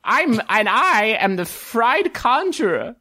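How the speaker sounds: high-voiced, showy dwarven vendor; eager high-pitched stallholder patter; dwarven fried-food conjurer. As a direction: pitch your magical fry booth in a high voice.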